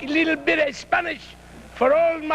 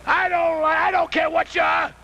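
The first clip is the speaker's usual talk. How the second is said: usual speaking voice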